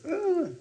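high dismayed groan; a reluctant audience groan; mock groan. Preacher imitating listeners balking at half an hour, groaning. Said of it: "you'd all start".

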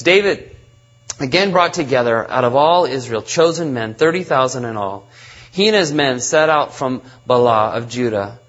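measured, solemn Bible reading; scripture reading. Acted. Reads the scripture passage aloud.

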